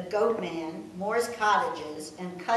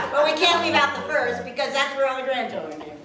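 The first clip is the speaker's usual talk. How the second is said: laughing; 6 semitones higher, 7 dB louder, faster than usual. Real, not acted. Still laughing after spouse joke.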